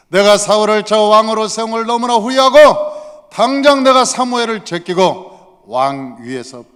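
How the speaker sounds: loud, furious regret; divine anger. Acted. God regrets making Saul king, angry. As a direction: declare it forcefully, as an angry God. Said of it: "막 그러면서 화를 냈어요".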